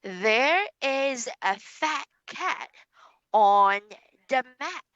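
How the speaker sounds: put-on slow, careful teacher reading for a child to copy; slow model sentence. Shown as a demonstration. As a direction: read it slowly so the child can repeat. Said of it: "listen to teacher".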